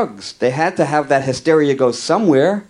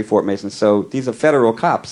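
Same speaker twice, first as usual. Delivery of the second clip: usual speaking voice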